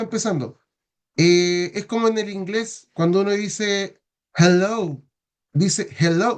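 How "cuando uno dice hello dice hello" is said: hello with a clearly breathed h; hello, h sounded; aspirated h. Shown as a demonstration. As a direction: model English hello with its h. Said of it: "no dice ello".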